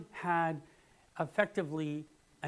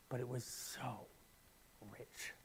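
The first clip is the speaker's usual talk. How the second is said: whisper; 3 semitones lower, 11 dB quieter, slower than usual. Real, not acted. Dropping to a whisper.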